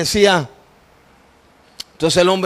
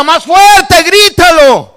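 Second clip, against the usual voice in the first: very high, loud command; insistent shout for more; shouted urging; 9 semitones higher, 12 dB louder, much slower than usual. Retold or imitated. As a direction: egg him on at full volume. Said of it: "Y él me decía".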